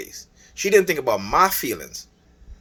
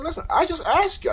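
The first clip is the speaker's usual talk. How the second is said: much higher, pleading protest; exasperated retort. Retold or imitated. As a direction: throw up your hands: I only asked. Retold quarrel, his own reply.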